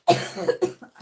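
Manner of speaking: loud harsh cough. Real, not acted. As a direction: cough hard. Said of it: "Oh, dear me".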